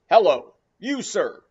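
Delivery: captain hailing Queequeg angrily; angry hail. Acted. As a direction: bark at the stranger across deck. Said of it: "cried the captain".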